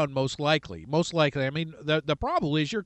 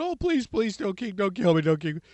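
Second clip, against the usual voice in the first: panicked pleading; 2 semitones higher than usual. Retold or imitated. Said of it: "Haman's all in a panic".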